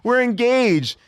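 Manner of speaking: high, slow, thrilled; giddy announcement. Retold or imitated. Telling the hotel clerk the news, giddy and high. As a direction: announce it bursting with joy. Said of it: "We're like".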